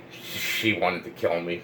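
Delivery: dropped low and soft in disbelief; stunned hush. Real, not acted. Telling viewers the girl wanted to kill him, hushed and stunned. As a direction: let it sink in, barely above a breath.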